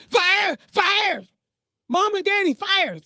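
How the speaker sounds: high-pitched cries of alarm; sisters screaming. Retold or imitated. Sisters warning their parents of a fire, screaming. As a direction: shriek the alarm like panicked sisters. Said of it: "They screaming".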